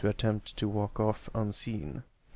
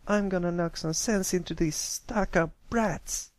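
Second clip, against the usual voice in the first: angry filly; 8 semitones higher than usual. Acted. Held back, threatening her tormentors.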